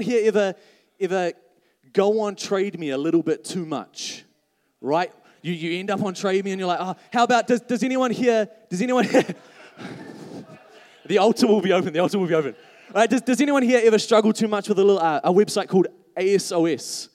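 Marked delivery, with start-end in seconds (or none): none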